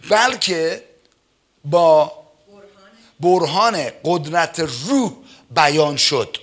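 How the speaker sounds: slow, weighty reading of Paul's words; solemn scripture reading. Acted. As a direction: read each phrase slowly and with emphasis. (خوانش آرام و باشکوه آیه)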